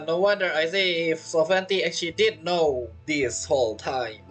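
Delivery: usual speaking voice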